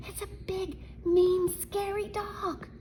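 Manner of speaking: high, slow scared whisper. Acted. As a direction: breathe it out like a frightened crook. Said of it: "whispered one burglar".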